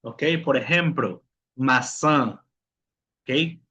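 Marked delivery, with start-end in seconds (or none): 1.6-2.4 "maçã" with a nasalised final vowel; nasal vowel. Shown as a demonstration. Teacher giving an example of the tilde, nasal ending on "maçã". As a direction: let the end of "maçã" ring through the nose. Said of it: "con un sonido nasal".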